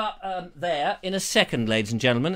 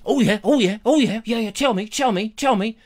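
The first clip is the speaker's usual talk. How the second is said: mock Trump; 3 semitones higher, 5 dB louder than usual.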